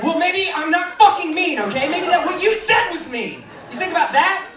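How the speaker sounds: high, loud mock-indignant outburst; angry comeback. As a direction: lash back, wildly defensive. Acted outburst back at a child.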